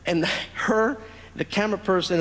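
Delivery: ordinary talk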